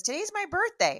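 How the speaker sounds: high, loud birthday announcement; excited announcement. Retold or imitated. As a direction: announce it loudly in a lifted voice, imitating a neighbour. Retelling neighbour's birthday announcement.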